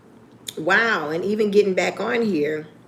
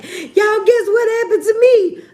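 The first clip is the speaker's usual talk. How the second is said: much higher, louder mock excitement; eager to share; 13 semitones higher, 6 dB louder than usual. Imitating herself greeting viewers from the car, much higher and louder. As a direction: burst in with news for the viewers. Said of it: "I would be crying and be like".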